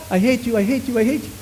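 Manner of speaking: high, fading repeated echo of hate. Retold or imitated. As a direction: let it ring back fainter. Imitating the echo.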